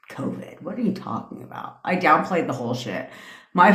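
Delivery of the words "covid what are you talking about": dismissive whisper. Retold or imitated. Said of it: "I was like".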